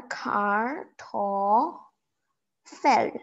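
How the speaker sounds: "car, to, fell" as three separate syllables; syllable-by-syllable pronunciation. Shown as a demonstration. Teacher sounds out Kartoffel for learners, syllable by syllable. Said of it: "You pronounce it as".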